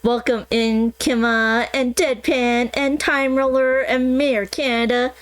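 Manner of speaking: ordinary talk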